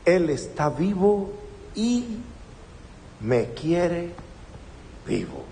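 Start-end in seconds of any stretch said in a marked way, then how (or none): none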